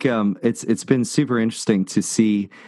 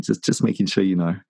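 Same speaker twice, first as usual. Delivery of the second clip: usual speaking voice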